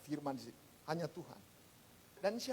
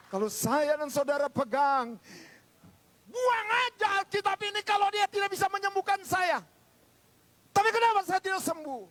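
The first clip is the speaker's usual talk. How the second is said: high, loud pulpit challenge; fiery preacher at full volume; full-force preaching; 11 semitones higher, 9 dB louder than usual. Real, not acted. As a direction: shout it as an impassioned challenge.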